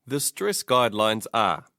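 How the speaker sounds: ordinary delivery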